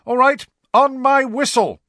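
high, loud call over rain; shouting. A narrator's reading. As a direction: shout across the pitch. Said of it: "shouted Angelina".

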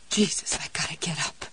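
hoarse whispered croak; croaking husky voice. A narrator's reading. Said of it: "in a croaking, husky voice".

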